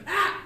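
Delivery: short, high, loud scream; sudden cry of alarm; panicked yelp. Acted. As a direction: yelp like someone lost. Panicking, lost in men's rooms.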